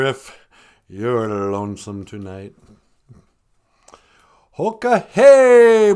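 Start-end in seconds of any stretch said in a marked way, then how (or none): 4.6-5.9 loud, slow, drawn-out hail; loud greeting call. Pretend. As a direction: call it out to the whole room.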